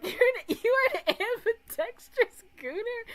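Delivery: high, loud incredulous question; retold disbelief. Streamer replaying his reaction to friend's boast, loud disbelief. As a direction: act out being flabbergasted, pitch way up.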